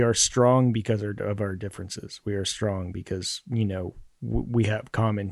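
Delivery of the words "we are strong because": normal talking voice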